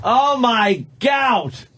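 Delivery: high, slow, drawn-out shout; shouted exclamation. Real, not acted. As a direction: cry out in disgust. Exclaims at the gout picture.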